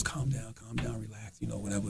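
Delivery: calm the situation quietly; hushed calming. Retold or imitated. Bank worker quietly calming the angry customer down. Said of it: "one of the ladies comes over and goes".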